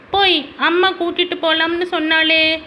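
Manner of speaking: put-on high little boy's objection; protesting child. Son disputing his father over the dog, protesting child.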